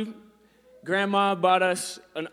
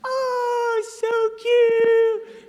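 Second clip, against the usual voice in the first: much higher, falsetto coo from onlookers; cooing passerby; 6 dB louder, much slower than usual. Retold or imitated. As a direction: gush like a stranger at a baby. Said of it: "they're just like".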